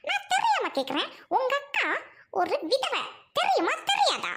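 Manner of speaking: high, sneering questions; taunting. Acted. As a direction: needle him with mock innocence. Wife taunts husband about widowed sister. (கேலியான ஏளனக் கேள்வி)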